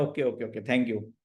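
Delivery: low, flat-toned ओके ओके ओके; bored monotone teacher reply. Shown as a demonstration. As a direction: drop the voice and drone it. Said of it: "हम भी monotone से बोलते हैं".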